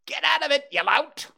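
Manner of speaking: one jay shooing the other off; angry bird yell. Acted. Two jays squabbling over food.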